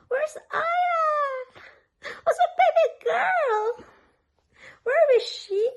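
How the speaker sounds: very high squealing peekaboo call. Real, not acted. Peekaboo game with the baby. (matinis na boses pambata)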